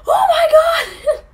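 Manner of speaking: very high, drawn-out yell; distant shout. Retold or imitated. Rescuers spotting stranded friend, high excited shout. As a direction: imitate an excited far-off holler.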